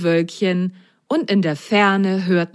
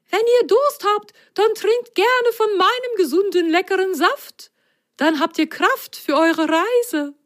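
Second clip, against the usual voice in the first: lemon's voice. Acted. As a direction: welcome them like a kindly giant lemon. Lemon offers juice.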